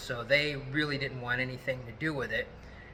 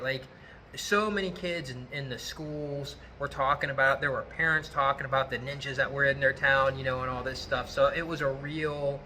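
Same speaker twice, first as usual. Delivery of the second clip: ordinary delivery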